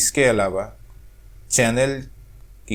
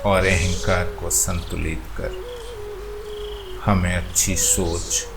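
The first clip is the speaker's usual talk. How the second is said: guided meditation; 2 semitones lower than usual. Acted. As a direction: guide them gently into stillness, phrase by phrase. Leads a chakra meditation.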